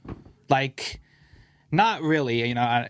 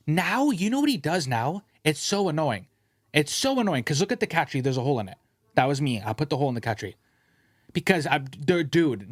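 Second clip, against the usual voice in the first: normal talking voice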